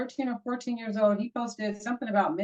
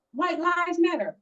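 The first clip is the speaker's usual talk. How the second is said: mocking quote; 6 semitones higher, slower than usual.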